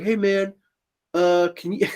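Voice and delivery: lightly voiced brother, half laughing; sheepish brother voice. Brother asks him to hold drugs, sheepish and laughing.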